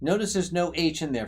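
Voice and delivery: normal talking voice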